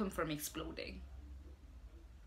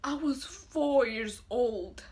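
tearful; 4 semitones higher, 3 dB louder, slower than usual. Acted. Mourner weeping at eulogy.

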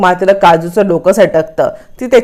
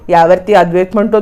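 ordinary delivery, nothing marked

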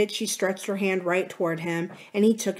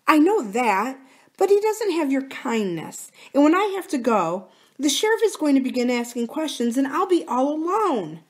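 higher, plaintive worried appeal; pleading wife; 8 semitones higher, 3 dB louder than usual. Acted. Wife afraid of the sheriff's questions, plaintively pleading. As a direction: work on his sympathy, voice pitched up.

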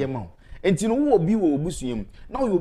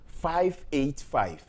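slow number dictation; 5 semitones lower, slower than usual. Acted. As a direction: spell out each digit slowly and clearly. Dictating phone numbers on air.

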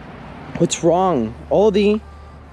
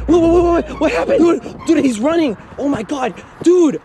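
startled, pitched-up exclamations; panicked alarm; 6 semitones higher than usual. Acted. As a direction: blurt it out in rising panic. Creature suddenly starts running.

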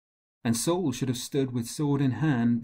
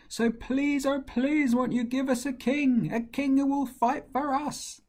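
high, beseeching voice of a desperate people; pleading crowd; 10 semitones higher than usual. Acted. The people beg God for a human king, high and pleading. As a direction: beg for it, pitch climbing as it goes.

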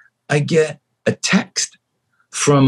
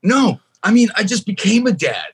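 excited insistence; 9 semitones higher than usual. Retold or imitated. Insisting to flight attendants.

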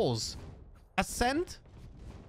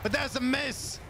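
raised, excited esports call; keyed-up shoutcaster commentary; live play-by-play; 6 semitones higher than usual. Real, not acted. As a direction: call each beat of the fight as it happens.

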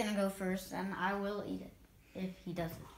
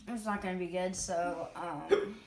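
ordinary delivery

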